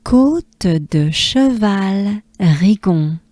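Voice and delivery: slow French drill, each syllable clear; slow careful French; French pronunciation. Shown as a demonstration. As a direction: sound out the French slowly.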